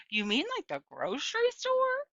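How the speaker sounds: put-on high incredulous old lady; amused old woman. A narrator's reading. Gloria asking Opal about the dog's name, amused. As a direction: ask it delighted and surprised.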